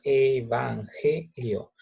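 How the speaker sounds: EVANGELIO very slow, one voice burst per syllable. Shown as a demonstration. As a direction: split EVANGELIO into e-van-ge-lio. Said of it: "es una emisión de voz".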